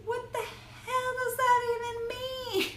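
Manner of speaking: high baffled question; mock baffled. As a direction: squeak it out, baffled.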